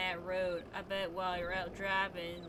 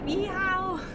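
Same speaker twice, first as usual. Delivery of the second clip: much higher and louder cowboy whoop; cowboy yeehaw; 11 semitones higher, 11 dB louder than usual. Pretend. Southern life cheer.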